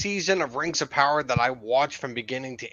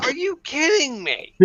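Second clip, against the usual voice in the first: incredulous; 10 semitones higher than usual. Retold or imitated. Retelling his reaction to the scene.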